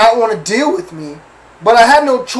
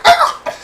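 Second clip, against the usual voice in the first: sudden high squeal for the car jolting; shrill mock car shriek; car noise imitation. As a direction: voice the car yelping like it got hurt. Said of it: "My car was like".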